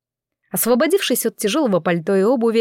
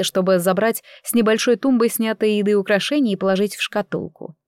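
usual speaking voice

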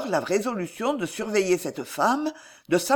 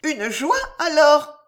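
high voice, loud on the last word; insistent old aunt; 9 semitones higher, 6 dB louder, slower than usual. A narrator's reading. Old aunt presses her stricken niece, high insistent question. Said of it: "insista l'atroce vieille".

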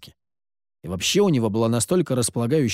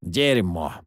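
calm mentor tone; 7 semitones lower than usual. A narrator's reading. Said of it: "спокойным менторским тоном сказал Сид".

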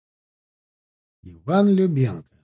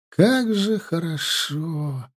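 very slow, drawn-out groan of pleasure; a satisfied killer sighing with delight; blissful moan; 3 semitones higher, much slower than usual. A narrator's reading. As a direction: moan it out in release. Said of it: "простонал он".

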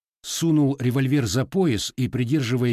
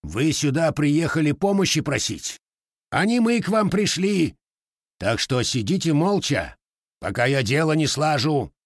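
growled; 6 semitones higher, 3 dB louder than usual. A narrator's reading. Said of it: "прорычал Лукич".

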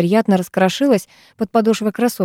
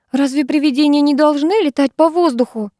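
high, strained anxious question; lightly acted agitation, pitch raised; choked with nerves; 7 semitones higher than usual. A narrator's reading. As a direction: ask with nervous tightness. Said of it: "сдавленным от волнения голосом спросила Диана".

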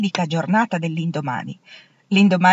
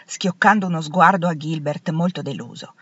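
ordinary delivery, nothing marked

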